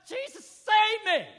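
slow, high, shouted plea; desperate cry to be saved; cries out. Acted. Peter sinking, crying out to Jesus. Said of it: "He cries out".

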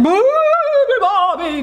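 high elephant trumpet call. A narrator's reading. Elephant wakes the sleeping bird, trumpeting. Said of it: "trumpeted the elephant".

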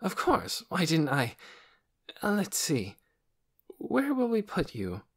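suddenly remembering, all in a flap; scatterbrained doctor. A narrator's reading. Doctor realizing she has no beds, flustered.

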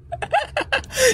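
high, wild villain laugh; evil cackle. Acted. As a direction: cackle like a mad ringmaster.

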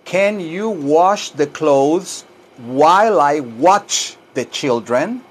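slow, drawn-out English for repeating; slow teaching model. Shown as a demonstration. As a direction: model the sentence slowly, word by word. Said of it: "tal como lo oye que yo lo digo". (inglés lento para repetir)

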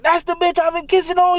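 high, disbelieving cry; shocked realization. Retold or imitated. Learning who he kissed, a high shocked cry. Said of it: "I'm like".

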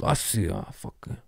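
soft, fumbled attempt at a celebrity's accent; botched [name] accent. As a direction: fumble quietly for an accent you can't do. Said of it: "I don't know how he sounds".

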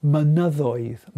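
"Mynyddoedd" drawn out, stressed on the middle syllable; penultimate stress. Shown as a demonstration. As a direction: slowly model "Mynyddoedd", weight on the second-to-last syllable. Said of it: "stress is on the penultimate syllable".

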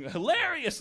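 high, lightly mocking hilarious; smug fan checking the photo; amused stranger. Strangers check the photo near Kato, high amused voice. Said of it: "looking at the phone and going".

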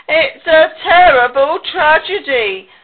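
loud, high theatrical moan; melodramatic, woeful pig; moaning lament. Acted. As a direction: moan it like a tragic hero. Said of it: "moaned Pig".

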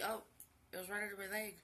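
ordinary talk

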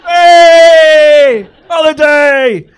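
high, loud, slow party shout; cheesy holiday yell. Pretend. Comic yelling a party cheer at the crowd. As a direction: whoop like a package tourist.

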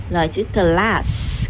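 normal talking voice

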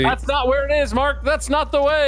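high, urgent warning call. Pretend. Player warning Mark he is going wrong, urgent call. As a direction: call after someone going astray. Calling after straying teammate.

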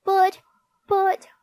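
high, loud stammered protest; shocked little-boy protest, broken by pauses; Perfect Peter's gasp. A narrator's reading. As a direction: gasp out a helpless objection. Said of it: "gasped Perfect Peter".

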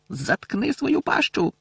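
high, slow shout. A narrator's reading. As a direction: yell it at him, drawn out. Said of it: "крикнув Рон".

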